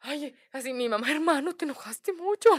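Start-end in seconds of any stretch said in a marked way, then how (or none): 1.1-2.5 higher mom voice. Retold or imitated. Mom gently scolding uncle.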